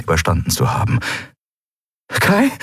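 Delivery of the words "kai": keuchend. A narrator's reading. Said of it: "keuchte Endriel".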